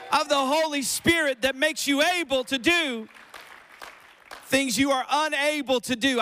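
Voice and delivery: loud, high, driving sermon delivery; full-force preaching. Real, not acted. Pastor rousing the room for the Holy Spirit, full-force preaching.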